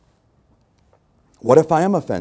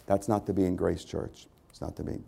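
fading to whisper; 4 semitones lower, 8 dB quieter than usual. Acted. Fades to a whisper.

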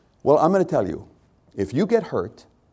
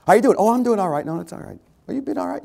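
high, bright chit-chat voice, higher; mock cheery greeting; 9 semitones higher, faster than usual. Pastor acts out greetings at the church door, pitched high. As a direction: play two people trading high greetings.

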